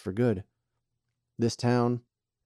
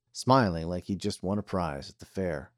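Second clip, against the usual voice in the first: usual speaking voice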